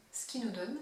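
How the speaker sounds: ordinary delivery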